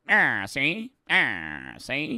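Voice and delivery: put-on old-time man's catchphrase voice; stern old-timer impression. Streamer voicing a stern man in an old photo, old-timer impression. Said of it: "That's how he sounds".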